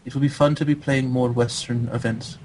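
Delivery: measured, accented player impression; Rhett. Host imitating an absent teammate chatting about Korea, accented. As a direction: speak carefully, as an imitated foreign gamer. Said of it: "wanna hear my Rhett impression".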